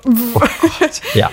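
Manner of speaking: high giggling oh Gott; stifled giggles. Real, not acted. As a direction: squeak it through a laugh.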